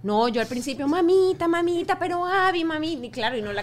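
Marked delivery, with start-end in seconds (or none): 0.9-2.9 a touch of soothing, higher and a little louder; lightly imitating coaxing a toddler; mild sweet pleading to a daughter; coaxing mom. Mother coaxing screaming child in airport.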